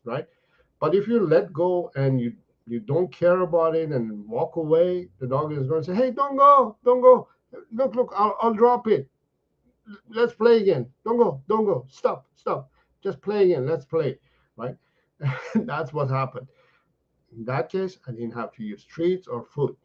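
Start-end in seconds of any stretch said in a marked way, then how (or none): 5.9-14.2 much higher, loud, desperate pleading; begging dog. Retold or imitated. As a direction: call after the leaving human. Dog begging owner to stay.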